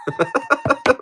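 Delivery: high short giggle; real burst of chuckling; laugh. Real, not acted. Host joking it's not a cereal, giggling laugh.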